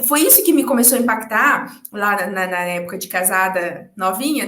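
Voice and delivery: normal talking voice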